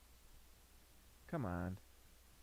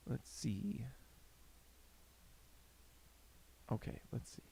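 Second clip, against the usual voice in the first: whisper; 7 semitones lower, 16 dB quieter than usual. Real, not acted. Late night, whispering.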